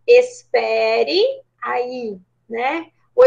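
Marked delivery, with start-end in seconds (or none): 0.1-2.2 very slow, each syllable of "espere aí" drawn out; formal phrase, slowly. Shown as a demonstration. Teacher dictating the formal phrase to students, slow and deliberate. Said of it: "a gente ia colocar como".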